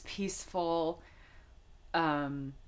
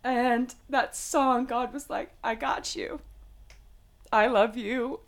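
tearful; 5 semitones higher than usual. Real, not acted. Recounting God's comfort to a friend, still tearful. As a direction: still crying as she tells it, voice breaking. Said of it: "don't ever apologize for your feelings".

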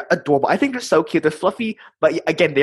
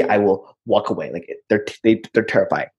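ordinary talk

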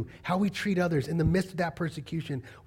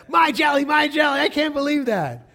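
loud, high mock wailing outrage; hammed-up tantrum over something small; flipping out; 10 semitones higher, 8 dB louder than usual. Dad lamenting his lost jelly to his daughter, flipping out. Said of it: "you flip out".

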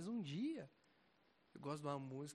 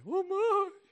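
loud high call across the house; real call to his wife; 16 dB louder, much slower than usual. Real, not acted. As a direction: shout for your wife. Calling his wife from afar.

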